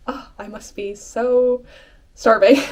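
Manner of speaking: mock starving. Joking after "I don't eat.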